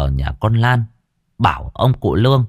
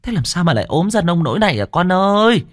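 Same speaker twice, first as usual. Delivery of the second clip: high, alarmed grandmother; startled grandma crying out; grandmother's cry of alarm; 9 semitones higher than usual. A narrator's reading. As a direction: exclaim in shock over her thin face. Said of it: "thì thốt lên".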